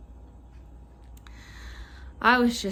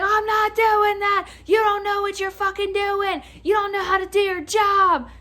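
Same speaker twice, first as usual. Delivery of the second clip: high, loud mock customer tirade; imitated flipping out; 9 semitones higher, 4 dB louder than usual. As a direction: mimic an irate caller screaming insults. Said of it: "he flips out some more".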